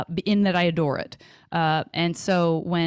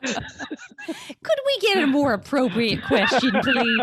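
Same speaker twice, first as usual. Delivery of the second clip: mock indignant; 8 semitones higher, 3 dB louder, slower than usual. Joking demand for another question.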